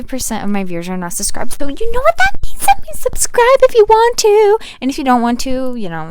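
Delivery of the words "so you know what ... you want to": high, loud sing-song plea; excited. Real, not acted. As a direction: pitch subscribe like a hype host. Hyped subscribe plea.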